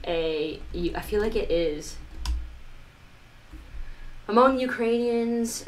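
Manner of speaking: ordinary talk, nothing marked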